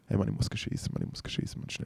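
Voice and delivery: desperate half-whisper, fading out; urgent hushed plea. Retold or imitated. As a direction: beg quietly, almost whispering. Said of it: "dann sagt er irgendwann so".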